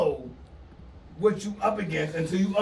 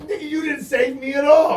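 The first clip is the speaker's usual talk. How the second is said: loud, high retort broken by laughter; laughing shout; 7 semitones higher, 5 dB louder than usual. Retold or imitated. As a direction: yell it while cracking up. His own retorted quote.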